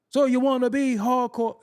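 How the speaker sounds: loud, high rap-style quote. Retold or imitated. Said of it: "It sounded like Pac".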